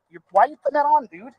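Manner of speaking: ordinary talk, nothing marked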